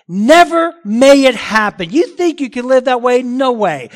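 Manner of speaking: emphatic. Retold or imitated. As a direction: thunder it at the congregation as an absolute ban. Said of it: "he's very emphatic".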